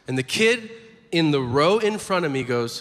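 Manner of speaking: ordinary talk, nothing marked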